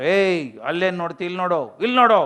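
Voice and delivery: mock stern telling-off, bursts of loudness; imitated scolding. Strict teacher demanding pupils look this way, mock scolding. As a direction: scold like a strict teacher, punching the last command. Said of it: "ಅಂತ ಬೈದ್ರು".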